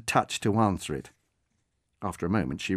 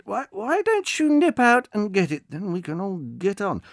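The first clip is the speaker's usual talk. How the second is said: patient coaxing; 10 semitones higher, 5 dB louder than usual. Acted. Delivery man urging the old woman outside, patient. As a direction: coax her gently outside.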